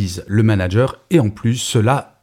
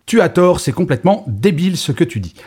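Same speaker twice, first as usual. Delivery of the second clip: harsh put-down; 6 semitones higher than usual. Shown as a demonstration. Manager humiliating collaborator.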